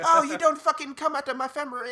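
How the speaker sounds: high, loud imitated outrage; mock angry caller. Caller threatening him over her family, mock angry voice. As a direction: rant high and loud as the woman.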